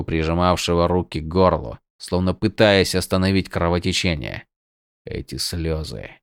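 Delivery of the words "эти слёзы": hushed. A narrator's reading. Shocked whisper-quiet line.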